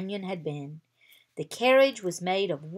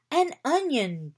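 higher, eager outburst; excited cry; 6 semitones higher, 4 dB louder than usual. A narrator's reading. Drake offers an idea for the spell, crying out excitedly. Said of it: "Drake cried".

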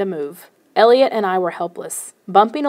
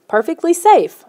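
excited reassurance; 9 semitones higher, 5 dB louder than usual. Acted. Jay reassuring frightened friend.

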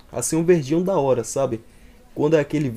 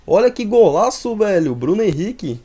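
higher and louder, building to the goal; explosive goal commentary; 7 semitones higher, 8 dB louder, slower than usual. Real, not acted. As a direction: belt out the goal call like a TV narrator. Own live call of a goal.

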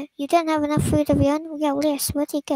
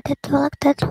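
ordinary delivery

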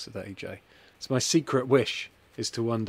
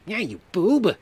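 Skeletor voice. Acted. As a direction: sneer it like a cartoon villain. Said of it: "I should do a Skeletor voice".

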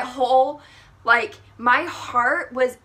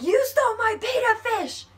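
high, loud accusing yell; retold scream; 12 semitones higher, 5 dB louder than usual. Worker confronting two suspected fish thieves, high loud yell. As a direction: cry out the accusation, pitched way up. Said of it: "in my face screaming at me".

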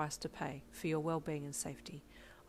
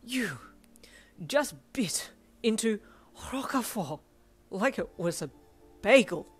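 breathless wheeze; 4 semitones higher, 9 dB louder than usual. A narrator's reading. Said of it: "he wheezed, catching his breath".

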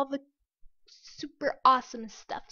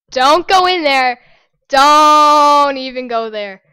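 loud warning; 13 dB louder than usual. Real, not acted.

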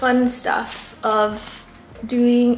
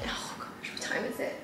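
whispered aside; 14 dB quieter than usual. Real, not acted. Suddenly notices the late time.

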